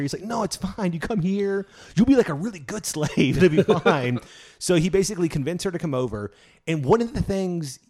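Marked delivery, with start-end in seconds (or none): 0.2-4.2 high, louder, breezy mock persuasion; mock reassurance. Jefferson persuading her to come over, mock reassurance. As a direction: coax her with hammed-up false reassurance.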